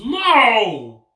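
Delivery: loud, high-pitched no; shouted refusal. Real, not acted. Friend asks to stab his leg, shouted refusal. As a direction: yell it in alarmed protest.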